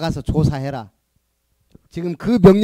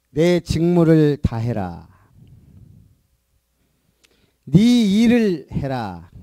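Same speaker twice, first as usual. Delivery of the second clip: preaching; 4 dB louder, slower than usual. Acted. Pastor proclaims Paul's command.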